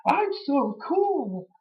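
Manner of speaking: high sarcastic wrestler impression; sarcastic Punk mockery. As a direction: sneer it in a squeaky mock voice. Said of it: "he's like".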